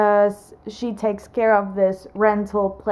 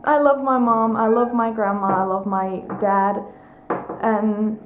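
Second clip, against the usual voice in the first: ordinary delivery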